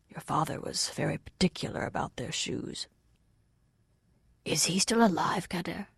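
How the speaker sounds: soft, calming voice; mild, peaceable Waylord. A narrator's reading. Waylord asking after the man's father, mild and peaceable. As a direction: settle a shaken man with quiet small talk. Waylord speaks gently to fugitive.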